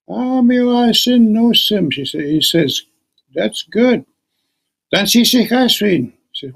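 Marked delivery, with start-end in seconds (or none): 0.1-1.9 put-on higher, slow grateful old voice; a lightly voiced old man, pleased. Old man thanking her. 3.3-6.1 a lightly voiced old man greeting kindly; old grandpa. Old grandpa greeting his visiting grandchild.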